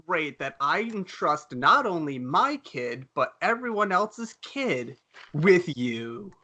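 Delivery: slow, drawn-out sarcasm; sarcastic, deliberate thanks to the school; mock gratitude. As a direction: stretch out the fake praise. Sarcastic reply to school's answer.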